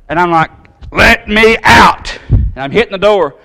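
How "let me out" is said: slow, higher, louder yell to be let out; angry shout. Retold or imitated. Shopper stuck at locked door, yelling to staff. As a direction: bang on the door and demand out.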